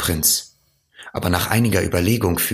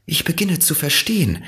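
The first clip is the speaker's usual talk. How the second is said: higher, dawning understanding; little prince; 11 semitones higher, faster than usual. A narrator's reading. Little prince beginning to understand the fox, higher voice.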